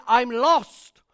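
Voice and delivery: a loud, plain I'm lost at the door; loud plea. Retold or imitated. Lost young man at the pastor's door, loud plea.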